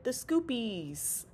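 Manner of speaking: ordinary delivery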